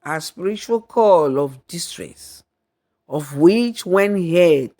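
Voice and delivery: plain reading voice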